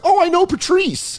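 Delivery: a high, proud, singsong boast. Retold or imitated. Young comic boasting to friends that he knows Patrice, high and proud. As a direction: puff up like a kid showing off a famous friend. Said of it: "I would be like".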